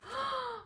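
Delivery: high quick gasp; delighted gasp. Real, not acted. Vlogger spotting the slime, a delighted gasp.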